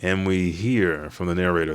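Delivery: ordinary delivery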